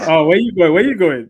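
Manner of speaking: laughing playful where you going. Real, not acted. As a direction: chuckle through it.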